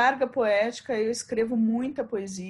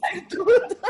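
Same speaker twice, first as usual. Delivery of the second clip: laughing, higher and louder; 7 semitones higher, 3 dB louder, slower than usual. Real, not acted. Echoing the interviewer's joke, laughing. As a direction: caught in a real laugh.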